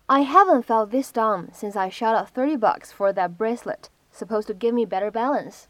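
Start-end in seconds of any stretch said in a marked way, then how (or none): none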